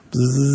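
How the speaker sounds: soft buzzing hum like a toy plane; buzzing noise. Acted. Pastor mimics a faraway toy plane, soft buzz. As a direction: buzz it softly, distant.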